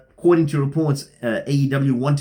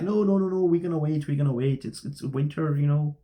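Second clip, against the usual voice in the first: put-on higher, softer jokey Canadian accent; mock Canadian accent; 3 semitones higher, 5 dB quieter than usual. Vlogger voicing Minnesota's refusal, mock Canadian accent. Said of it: "I don't know why I put on a Canadian accent".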